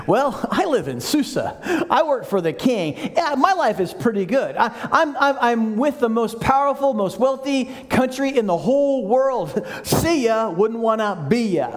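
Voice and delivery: high, breezy, self-satisfied mock voice; smug mock. Preacher mocking an indifferent Nehemiah, smug and breezy. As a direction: lampoon a comfortable shrug. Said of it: "He didn't say".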